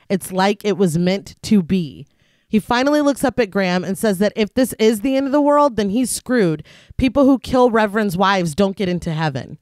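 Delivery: measured read-aloud film summary; scripted recap narration. A narrator's reading. Podcast host reads the film's plot to co-hosts, measured narration. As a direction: narrate the plot evenly, sentences falling at the ends.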